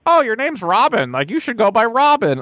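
high-pitched, mocking quote of a film character; mock movie lady. Podcaster mocking the lady's line to Joseph Gordon-Levitt, high mimicry. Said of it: "the lady's like".

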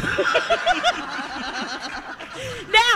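long, high burst of laughter; real laughter. Real, not acted. Storyteller after confessing her pond accident, helpless high laughter. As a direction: crack up at your own punchline.

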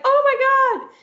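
high, loud mock gasp of wonder; amazed gasp. A friend visiting sees the sparkly globe, mock amazed gasp. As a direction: squeal it like a guest stunned by the globe. Imagined guest seeing the globe.

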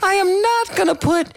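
falsetto. Shown as a demonstration. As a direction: protest way up in falsetto. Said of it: "take a falsetto second".

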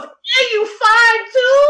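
very high, slow, sung pickup line; sing-song flirt imitation. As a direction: croon it like a cheesy flirt. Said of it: "He goes".

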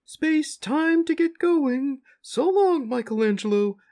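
high, far-off cow creature voice; a big gentle cow soaring up high; talking cow. Acted. Cow reassures the stranded little farmers in a high voice.

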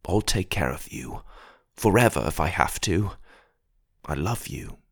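soft, low, near tears; tearful. Acted. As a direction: hold back tears while promising. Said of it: "I found myself starting to tear up".

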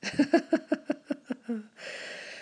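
high, falling giggle; high laugh. Real, not acted. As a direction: keep laughing at yourself. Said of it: "I'm still laughing".

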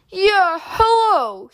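forcing a deep voice, playing an adult; silly put-on grown-up voice; fake deep voice. A narrator's reading. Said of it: "he calls in a fake deep voice".

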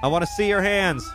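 high, firm police orders; commanding shout. Real, not acted. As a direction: order the suspect sternly. Commanding suspect at gunpoint.

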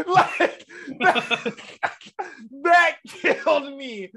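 high, loud words breaking into laughter; helpless laughter. Real, not acted. Podcaster cracks up over the notes, laughing through words. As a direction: barely get it out through laughing, stretched out.

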